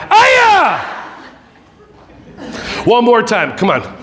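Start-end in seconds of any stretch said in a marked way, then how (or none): 0.1-0.8 high karate-style yell; playful scream. Shown as a demonstration. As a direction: let out a sudden high cry. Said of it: "if you wanna scream".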